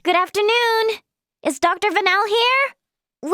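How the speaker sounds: high, lilting greeting call. Acted. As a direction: call into a room for someone. Calling into the infirmary.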